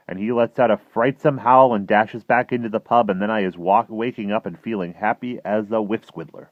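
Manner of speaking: loud, animated storytelling; dream label read aloud. A narrator's reading.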